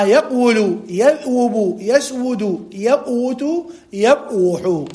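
"يقول، يذوب، يسود، يقوت، يبوح" each with still second letter and w before u; underlying verb forms. Shown as a demonstration. Teacher drilling original forms, "يقول، يذوب، يسود، يقوت، يبوح" split after the first syllable, w sounded. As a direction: sound the waw as a consonant in "يقول، يذوب، يسود، يقوت، يبوح": yaq-wulu, yadh-wubu. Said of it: "فالأصل في هذه الأفعال المضارعة قبل النطق".

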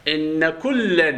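"إن" as in-na, with a held double n; stretched "إن كلا", the n of "إن" held long; doubled-n reading. Shown as a demonstration. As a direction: recite "إن كلا" very slowly, doubling the n of "إن". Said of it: "بالتجديد".

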